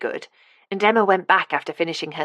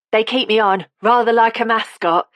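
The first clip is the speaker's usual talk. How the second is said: put-on higher, louder Cockney old woman; Cockney accent; 5 semitones higher, 5 dB louder than usual. A narrator's reading. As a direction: give it a chirpy Cockney lilt. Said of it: "said Carmel in a Cockney accent".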